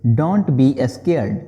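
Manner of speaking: very slow, word by word; slow model sentence. Shown as a demonstration. Teacher modelling the English phrase slowly for students. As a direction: spell it out slowly for learners. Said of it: "बोलेंगे".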